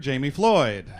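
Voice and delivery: ordinary talk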